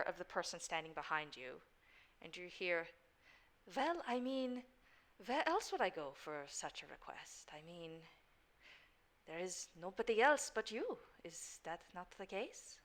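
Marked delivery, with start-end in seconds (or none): 3.8-12.8 a hushed, coaxing stranger making a request; softly persuade, dropping to a near whisper; lightly voiced stranger. A hint of a mysterious stranger pleading with Johan.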